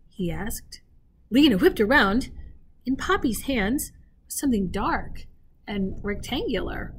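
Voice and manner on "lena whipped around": ordinary delivery, nothing marked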